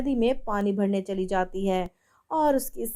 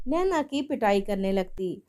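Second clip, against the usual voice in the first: ordinary delivery, nothing marked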